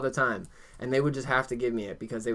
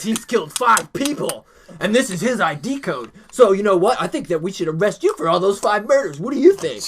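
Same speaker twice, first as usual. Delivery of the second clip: raised, dramatic mock interrogation; intimidating cop; 5 semitones higher, 5 dB louder than usual. Officers trying to scare a detained kid, hammed-up intimidation. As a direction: act out a cop piling on a scare story. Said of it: "they tried to scare me while I was there".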